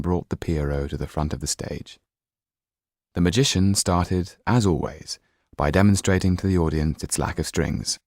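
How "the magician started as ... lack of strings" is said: plain reading voice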